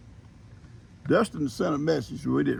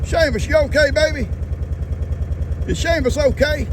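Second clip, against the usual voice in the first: worried pet talk; 13 semitones higher, 5 dB louder than usual. Real, not acted. Worried about hurt cat.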